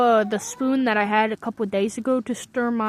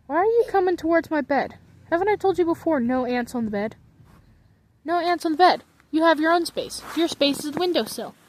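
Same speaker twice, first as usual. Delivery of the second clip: higher, louder playful telling-off; pretend-stern reprimand; mock scolding; 5 semitones higher, 4 dB louder than usual. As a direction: lay down the law to tiny intruders. Scolding the ants near bed.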